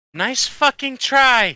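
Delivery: long, high, drawn-out shout; shouting from upstairs. Acted. Character yells down from upstairs after the shockwave, a drawn-out shout. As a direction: holler it slowly, high and loud. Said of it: "You can hear from upstairs".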